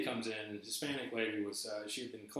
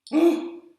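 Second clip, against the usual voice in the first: loud high gasp; 16 dB louder than usual. Real, not acted. Catching breath after the rant.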